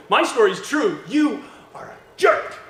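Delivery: loud, high, hammed-up outburst; mock accusation. A preacher mimics how we tend to assume, loudly accusing.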